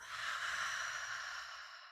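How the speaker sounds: forceful unvoiced exhale scream; whisper scream. Shown as a demonstration. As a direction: fog a mirror with a breathy scream. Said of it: "I'm gonna call it whisper scream on your exhales".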